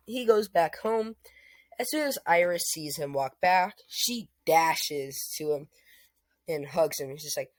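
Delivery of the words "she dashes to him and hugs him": usual speaking voice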